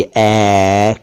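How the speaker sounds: "ए" held long as open "ae"; wide-open AI. Shown as a demonstration. Teacher modelling for viewers, long open "ए". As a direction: drop the jaw wide and sustain "ए" as "aeee". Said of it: "देखिए, बोलकर देखिए".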